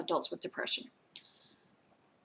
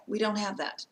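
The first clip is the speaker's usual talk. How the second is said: ordinary talk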